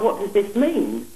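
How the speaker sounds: ordinary delivery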